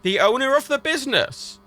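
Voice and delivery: high-pitched exasperation; exasperated answer. Retold or imitated. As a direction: spell out the obvious with strained patience. Answering a silly bank question.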